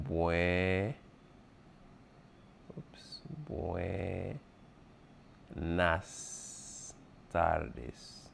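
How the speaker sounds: drawn out, very slow buenas tardes; slow demo. Shown as a demonstration. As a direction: spell it out slowly for students. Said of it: "Remember the A and the S".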